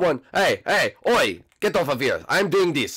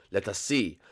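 ordinary talk, nothing marked

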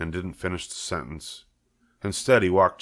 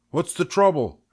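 higher, brisk farmer voice; farmer Zuckerman asking; 10 semitones higher, 4 dB louder than usual. Acted. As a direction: ask what's wrong, mildly concerned. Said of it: "asked Mister Zuckerman".

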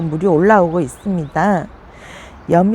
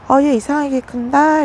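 puzzled remark; 8 semitones higher than usual. Retold or imitated. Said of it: "이랬는데".

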